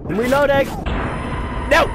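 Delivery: frantic cry under fire; panicked shout. Real, not acted. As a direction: yell it in a panic, high and loud.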